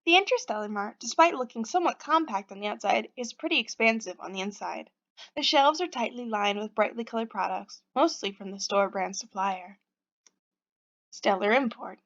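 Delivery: calm storybook narration; story narration. A narrator's reading. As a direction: read it like a storyteller. Reads game narration.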